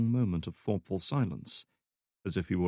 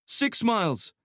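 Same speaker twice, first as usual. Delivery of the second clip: radio operator voice; 13 semitones higher, 3 dB louder, slower than usual. Acted. Agent answers Kamarov.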